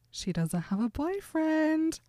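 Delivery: gasp, then high, drawn-out amazed realization; mock astonished gasp. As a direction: play up the thrilled discovery, high and slow. Retold Edward's delighted realization.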